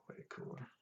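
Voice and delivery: whispered quote. Retold or imitated. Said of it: "she's like".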